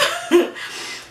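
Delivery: sudden high-pitched laugh; a burst of nervous laughter. Real, not acted. A high nervous laugh while telling of the crash.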